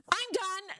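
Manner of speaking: high-pitched fed-up outburst. Retold or imitated. Bishop's wife quitting the meeting over tights, fed up. Wife flails arm, storms out.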